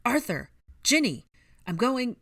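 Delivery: loud, high frantic calls; urgent cries, cut off; panicked. A narrator's reading. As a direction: call around in mounting panic. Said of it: "said in a panic".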